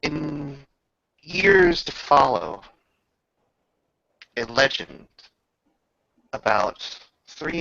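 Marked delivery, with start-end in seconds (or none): none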